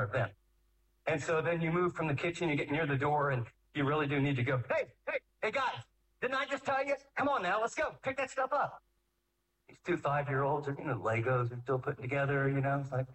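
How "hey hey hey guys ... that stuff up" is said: high-pitched parental nagging; exasperated parent. Acted. Parent at the door repeating himself to kids, high exasperated call.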